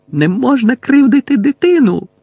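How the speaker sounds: high-pitched urgent protest; обурений вигук. A narrator's reading. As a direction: exclaim it in shocked reproach, high. Герміона зупиняє Гаррі, захищає дитину. (високий обурений вигук)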